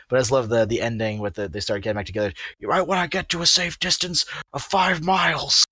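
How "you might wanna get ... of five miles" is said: higher put-on cartoon voice; character quote voice. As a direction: deliver it like a cartoon punchline. Quoting the episode ending.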